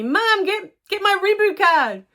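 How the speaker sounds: put-on high, loud impatient kid shout; retold kid yelling. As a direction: yell at mom for the reboot card. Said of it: "she's yelling at me".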